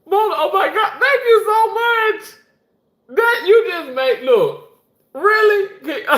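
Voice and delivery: very high, loud squeal through laughter; overjoyed thanks. Real, not acted. Host thanking a generous viewer, overjoyed.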